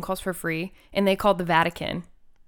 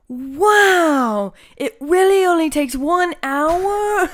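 wide-eyed fake wonder, higher, louder; mock amazement; 9 semitones higher, 7 dB louder, much slower than usual. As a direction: play dumb, amazed. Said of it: "And I was like".

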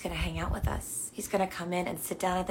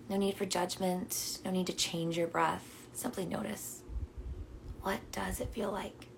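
calm meditation guide with long pauses; unhurried, soothing invitation to notice and breathe; guided meditation. Real, not acted. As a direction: guide them gently into their breath.

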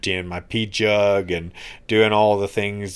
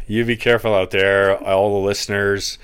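laughing; 6 dB louder than usual. Real, not acted. Laughing warning to listeners.

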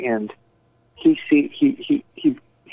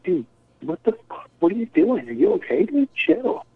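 alarmed remonstrance; 9 semitones higher than usual. Retold or imitated. Host telling his ranting friend to chill, retold high-pitched alarm. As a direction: imitate trying to rein in a friend.

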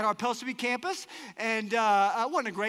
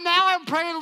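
ordinary talk, nothing marked

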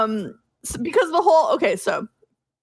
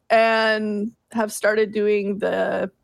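normal talking voice

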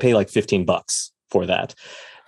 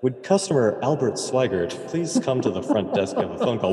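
PA announcement voice; flat echoey pa page; 3 semitones higher, 5 dB quieter than usual. Retold or imitated. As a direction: read it like a store intercom page. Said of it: "they would go on the P A".

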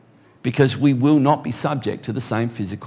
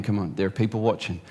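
softer, lower coaxing Holy Spirit; Holy Spirit gently urging a struggling believer on; gentle encouragement; 4 semitones lower, 6 dB quieter, faster than usual. Retold or imitated. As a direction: coax them along gently, hand in hand. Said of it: "the Holy Spirit is just holding your hand".

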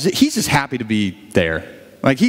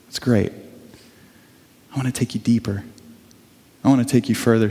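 gentle Jesus; 5 semitones lower than usual. Retold or imitated. Jesus speaks to believer.

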